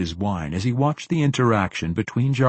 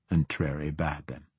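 ordinary delivery

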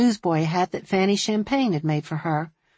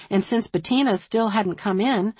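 plain reading voice

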